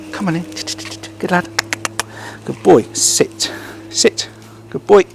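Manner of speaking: high coaxing praise with firm sit commands; dog commands. Real, not acted. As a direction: coax him in, then sit.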